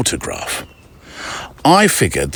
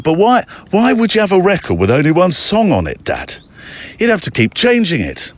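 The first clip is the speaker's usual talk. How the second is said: higher-pitched, baffled mock protest; imitating incredulous kid; 8 semitones higher than usual. Child questioning dad about one-song records, incredulous. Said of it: "he looked at me like I was mad".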